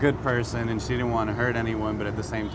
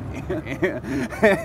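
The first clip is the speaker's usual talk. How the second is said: sudden high bright laugh; burst of laughter; 8 semitones higher, 5 dB louder than usual. Real, not acted. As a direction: laugh out loud at the memory. Laughs recalling being caught.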